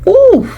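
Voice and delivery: delighted high-pitched ooh; admiring ooh at her own words. Real, not acted. Reacting to own line.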